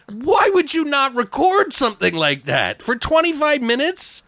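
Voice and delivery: high-pitched, exasperated retold question; incredulous protest. Teller asking partner why nothing was recorded, incredulous. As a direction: squeak it out in sheer disbelief.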